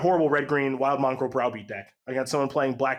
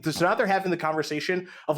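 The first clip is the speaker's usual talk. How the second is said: normal talking voice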